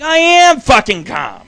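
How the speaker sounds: insisting he's calm, loud and high; loud protest. Real, not acted. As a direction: shout that you are calm, clearly not. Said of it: "calm down, [name]".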